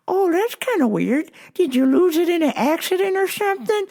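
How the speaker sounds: put-on high-pitched, puzzled old man; puzzled old customer. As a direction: play a clueless customer up high. Voices old customer in story.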